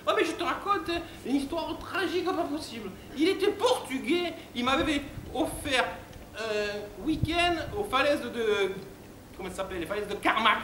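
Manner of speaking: high falsetto, plaintive and over-dramatic; bimbo recounting tragedy. Acted. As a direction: mourn in a silly high bimbo voice. Bimbo tells her tragic story. (voix aiguë de bimbo éplorée)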